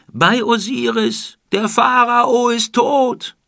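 loud, high, slow wail of shock; anguished cry. Acted. Old man outside the palace cries out the pharaoh's death. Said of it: "rief ein älterer Mann und schlug sich die Hände vors Gesicht".

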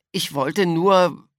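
put-on high, slow, hesitant child voice; slow, cornered, unsure; faltering start. A narrator's reading. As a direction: begin an excuse and leave it hanging. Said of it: "fing Florian an".

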